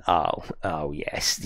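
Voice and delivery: low, slow, soft relish; slow, hushed satisfaction; savouring. Retold or imitated. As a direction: quietly retell a delighted reaction. Retells own first reaction to game.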